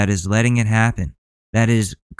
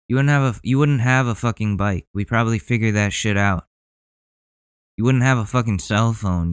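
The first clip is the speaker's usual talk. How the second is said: ordinary delivery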